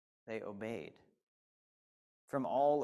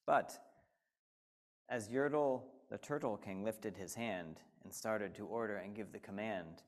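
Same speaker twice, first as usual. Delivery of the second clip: ordinary delivery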